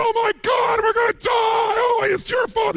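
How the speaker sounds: very high, shrill panicked yell; panicked scream. Retold or imitated. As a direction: shriek it like you're about to be eaten. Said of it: "He's screaming at the other guy".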